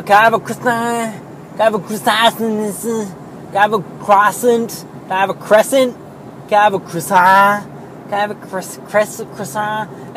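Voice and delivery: imitating customers fumbling "croissant" in many different ways; mangled croissant. Donut shop customers ordering at the counter, mock mispronouncing croissant. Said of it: "every possible mispronunciation of the word croissant".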